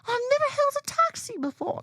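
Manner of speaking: very high, crying mock whimper; whimpering ditz. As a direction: whine it like a helpless tourist. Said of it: "And I'm like".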